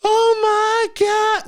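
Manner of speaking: high, slow, wailing mock sob; mock crying. The recruiter crying on the phone at him, high mock wail. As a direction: imitate a woman sobbing, hammed up. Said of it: "she starts crying".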